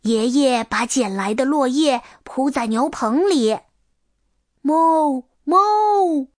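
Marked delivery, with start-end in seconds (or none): 4.6-6.3 long, high imitation moo; cow mooing. A narrator's reading. Cows calling out in the shed, drawn-out mooing. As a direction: moo twice, rising on the second. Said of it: "牛叫唤起来".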